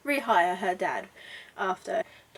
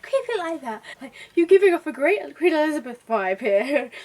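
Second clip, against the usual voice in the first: higher, louder upbeat praise; enthusiastic photographer; 5 semitones higher, 6 dB louder than usual. Retold or imitated. Photographer cheering on Harriet, louder and higher. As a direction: hype up the model. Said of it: "the photographer's like".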